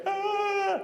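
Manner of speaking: very high, sustained mock scream; imitated scream. Storyteller as Johnny stung by fire ants, mock screaming. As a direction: mimic a boy shrieking from stings. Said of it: "He starts screaming".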